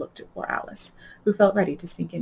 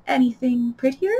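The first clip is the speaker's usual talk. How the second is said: doubtfully; 7 semitones higher, 4 dB louder than usual. A narrator's reading. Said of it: "said Alice doubtfully".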